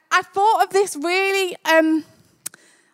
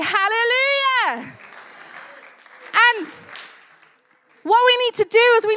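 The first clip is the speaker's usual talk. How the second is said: higher-pitched, fervent rallying cry; rousing preaching; 5 semitones higher than usual. Real, not acted. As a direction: preach it up high with conviction. Preacher rallying a lukewarm congregation.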